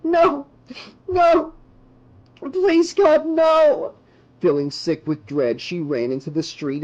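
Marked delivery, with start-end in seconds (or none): 0.0-3.9 high, loud, anguished pleading; desperate high screams; panicked shouting. A narrator's reading. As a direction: cry out in horror between pauses. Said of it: "she shouted".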